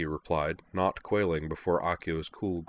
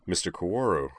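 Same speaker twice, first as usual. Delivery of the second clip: surprised; faster than usual. A narrator's reading. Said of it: "surprise in his voice".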